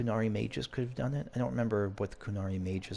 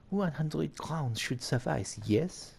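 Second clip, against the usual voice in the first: put-on slow, higher French-accented villain; 7 semitones higher, much slower than usual. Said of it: "you want the French accent".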